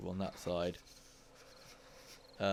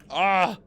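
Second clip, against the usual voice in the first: startled yelp; 9 semitones higher, 12 dB louder than usual. Real, not acted. Game mishap startles player.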